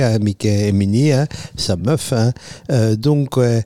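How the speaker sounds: put-on playful, thick Italian accent in French. Guest plugging his health weekend to the radio host, hammed-up Italian accent. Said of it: "sans accent".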